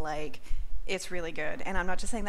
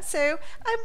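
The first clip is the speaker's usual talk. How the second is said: ordinary talk, nothing marked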